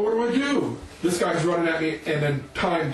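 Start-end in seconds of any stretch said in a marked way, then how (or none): 0.0-0.8 high-pitched, frantic plea; mock panic. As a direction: pitch it up as if desperate for help. Repeats question as attacker runs up.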